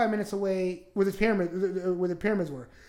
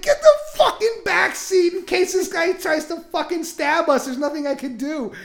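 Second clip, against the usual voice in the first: high, loud exasperated command; urgent mock order; 8 semitones higher, 6 dB louder than usual. Telling the roommate to sit in back, urgent order. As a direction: bark it at your roommate in a panic.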